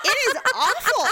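high, pinched disgust; gagging at a rank smell. Retold or imitated. Retelling reaction to dog's breath.

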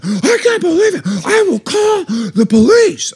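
high, stretched-out mock shriek; screaming neighbour. Angry neighbour threatens her at the door, screaming. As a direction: threaten in an outraged, high-pitched imitation. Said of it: "He is screaming, full on berating me".

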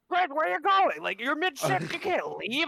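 high, loud mocking coworker voice. Coworker yelling at him to stay.

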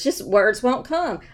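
plain reading voice

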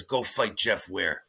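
a hint of a cold coach sending him to fight; coach's order. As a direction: deliver the order low and slow. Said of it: "he taps me and says".

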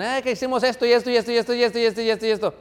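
voz aguda y acelerada de niño que lo cuenta todo; niños grabadorcitas. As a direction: imita a un niño soltando una lista sin parar, más agudo. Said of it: "esos son grabadorcitas. Vienen y nos dicen". (imitando a niños chismosos, como grabadoras a toda velocidad)

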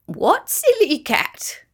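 high, slow, drawn-out bird voice; amused kookaburra. A narrator's reading. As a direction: ask it with a birdlike lilt. Kookaburra character speaks.

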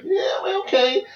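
high, slow, whiny; high whiny mock voice. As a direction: mimic a whimpering reply. Friends replying, high whiny.

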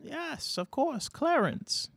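high, soft doddering grandpa; a very old man, pleasantly confused; ancient old man. Acted. Old man NPC answers.

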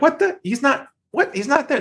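high, soft, bewildered squeak; mock bafflement. Rep reaching voicemail, high, soft mock confusion.

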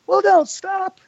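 very high put-on Arn voice; Arn impression. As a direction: stay in the silly old-timer voice. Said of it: "everybody has their own Arn impersonation".

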